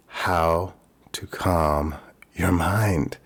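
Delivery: slow, spaced-out words for emphasis; slow and deliberate. Real, not acted. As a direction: draw each word out, with space between. Slowly stressing the core issue.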